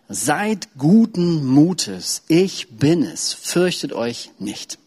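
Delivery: very slow, weighty words of Jesus; solemn reading. Acted. Preacher reads Jesus comforting the disciples, very slow. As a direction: draw out every word. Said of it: "redete Jesus zu ihnen und sprach".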